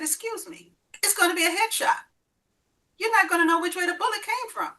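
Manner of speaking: high, loud, incredulous protest; alarmed outburst. Retold or imitated. Mother refusing to be used as FBI decoy, alarmed and loud. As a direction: push back hard, voice shooting up in disbelief.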